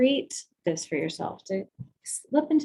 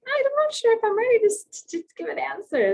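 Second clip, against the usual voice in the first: much higher, fast nervous rush that settles; unsure inner voice; 13 semitones higher, faster than usual. Retold or imitated. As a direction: blurt out the anxious doubt. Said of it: "you might feel like".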